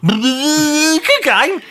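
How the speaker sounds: very high, long wind-up into the phrase; squealed catchphrase. Pretend. Answering the co-host's game, squealed catchphrase.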